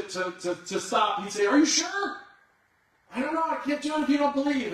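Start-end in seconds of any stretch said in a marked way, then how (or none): 1.5-4.7 high, loud teasing doubt; mock hesitation. Showman pretending to stop, teasing the crowd in a loud high voice. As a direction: fake cold feet to work up the crowd. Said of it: "he would pretend to, to stop".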